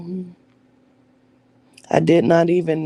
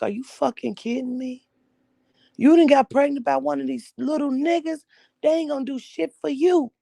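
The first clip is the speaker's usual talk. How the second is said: high-pitched, exasperated fatherly outburst; angry dad; 8 semitones higher than usual. Retold or imitated. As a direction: act out the furious father yelling at his daughter. Dad furious at pregnancy news.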